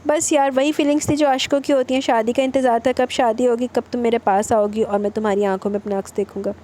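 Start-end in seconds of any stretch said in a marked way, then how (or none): none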